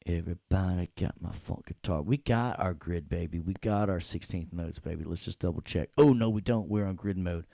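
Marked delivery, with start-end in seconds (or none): none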